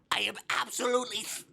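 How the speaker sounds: abandoned comic squawk; Gilbert Gottfried impression. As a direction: attempt the high squawk and break off. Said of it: "I can't do it".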